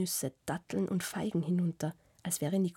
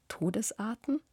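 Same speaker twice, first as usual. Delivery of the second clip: amused; 4 semitones higher than usual. A narrator's reading. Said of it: "liest belustigt".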